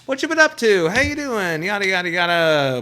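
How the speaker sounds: high, loud fake-friendly hello; mock cheery greeting. Talk show host greeting a guest, hammed-up cheery. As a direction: gush like you haven't seen them in days. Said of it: "give it a few days".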